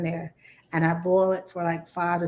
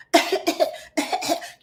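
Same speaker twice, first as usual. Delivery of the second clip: demo dry cough; 11 semitones higher than usual. Shown as a demonstration. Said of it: "your cough doesn't sound like".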